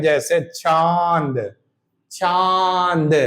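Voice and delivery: very slow चांद with a nasal vowel; teacher demonstrates the chandrabindu; nasalized vowel demo. Shown as a demonstration. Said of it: "नाक से भी हवा निकलेगी".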